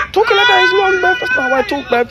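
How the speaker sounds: loud, very high indignant squeal; high-pitched protest. Acted.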